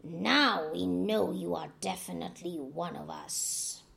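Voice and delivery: lightly voiced, approving crocodiles. A narrator's reading. Crocodiles accept Anansi.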